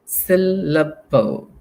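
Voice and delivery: syllable said slowly, syl-la-ble, each beat separate; syllables broken up. Shown as a demonstration. Teacher to students, breaking syllable into beats. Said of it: "remember what syllable means".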